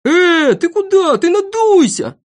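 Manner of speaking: very high, loud yells; piercing, bossy outcry; fussing shout. Acted. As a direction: shout meddling orders in alarm. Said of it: "вертелся вокруг да кричал".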